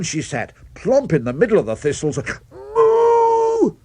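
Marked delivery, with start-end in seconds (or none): 2.5-3.8 loud, very high moo of shock; startled moo. Acted. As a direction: moo as if sat on thistles. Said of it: "jumped ten feet in the air".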